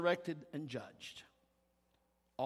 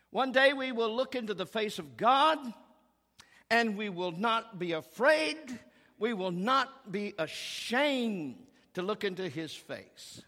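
raised, ringing pulpit voice; fervent preaching; 6 semitones higher, 5 dB louder than usual. Acted. Preacher proclaiming the vision of heaven to his congregation, fervent. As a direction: lift the promise to the whole church.

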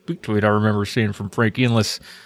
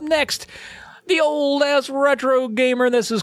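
high announcer voice; 12 semitones higher than usual. Acted. Hyped intro of a listener.

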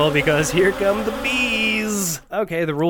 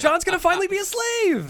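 gleeful shout; 13 semitones higher than usual. Real, not acted.

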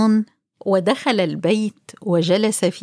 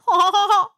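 donkey bray; 11 semitones higher than usual. Acted. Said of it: "ونهق وصرخ في وجهه".

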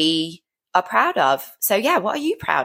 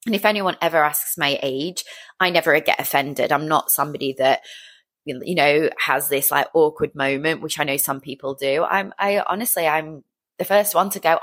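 usual speaking voice

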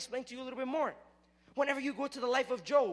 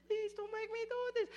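pleading; 12 semitones higher than usual. Retold or imitated. Jesus pleading in Gethsemane.

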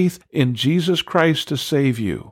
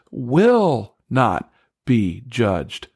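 very slow, firm "will not be judged"; deliberate, weighty emphasis on "will"; stress on WILL; much slower than usual. Shown as a demonstration. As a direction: lay down "will" as certain. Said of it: "it is".

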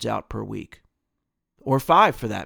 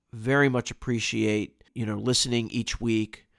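ordinary delivery

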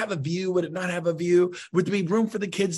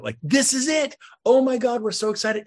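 higher, louder gush; excited buyer; 7 semitones higher, 4 dB louder than usual. Retold or imitated. Agent acting out thrilled buyers, excited. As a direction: squeal like buyers who found it. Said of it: "And I go".

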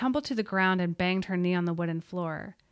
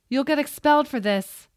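high loud threat; angry shout; 7 semitones higher, 9 dB louder than usual. A narrator's reading. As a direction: yell the threat through the door. Furious teacher through door.

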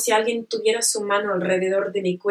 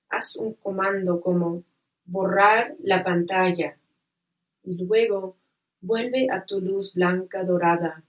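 calm, unhurried visualization voice; guided meditation. Acted.

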